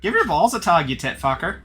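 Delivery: Canadian catchphrase. Pretend. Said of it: "going Canadian".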